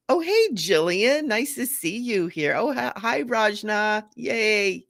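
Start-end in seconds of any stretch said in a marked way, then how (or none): none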